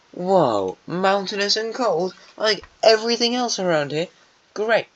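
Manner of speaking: high-pitched mock amazement; sarcastic. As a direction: feign being wowed, way up high and drawn out. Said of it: "the Terracotta Nation invented sarcasm".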